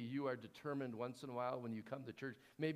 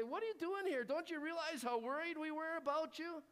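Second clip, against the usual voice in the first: higher, mock-scolding mother; scolding parent; 12 semitones higher than usual. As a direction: scold the lost boy like a worried parent. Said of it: "they kinda scold him".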